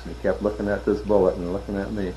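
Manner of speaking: ordinary delivery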